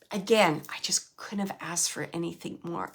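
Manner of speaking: hushed whisper. Retold or imitated. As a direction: pass on her gratitude in a breathy hush. Mother's message relayed in whisper.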